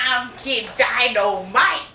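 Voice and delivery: slow, drawn-out celebrity voice; impersonation. Acted. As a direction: say it like a famous wrestler's catchphrase. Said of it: "I'm gonna do the impersonation".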